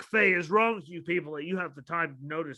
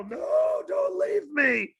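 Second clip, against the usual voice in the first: hammed-up graveside shout; mock wail; 12 semitones higher, much slower than usual. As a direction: cry out like a mourner left behind. Acting mourner at a casket.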